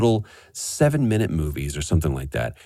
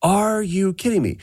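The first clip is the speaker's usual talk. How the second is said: much higher, stunned and delighted; amazed disbelief; 14 semitones higher than usual. Retold or imitated. Opening a DVD in the mail, high amazed disbelief. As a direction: pitch up in happy shock. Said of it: "I was like".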